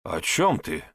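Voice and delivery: slightly guarded question; tense. A narrator's reading. Father Sebastian challenged about his identity, tensing up. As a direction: ask warily, a touch of tension. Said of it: "напрягся отец Себастьян".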